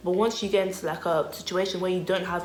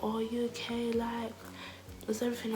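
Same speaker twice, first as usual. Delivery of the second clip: hushed, higher-pitched check-in; imitated concerned stranger; 4 semitones higher, 9 dB quieter than usual. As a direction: softly mimic a worried lady. Imitates concerned lady on train.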